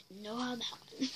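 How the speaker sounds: quiet wry repeat. Real, not acted. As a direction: echo it quietly to yourself.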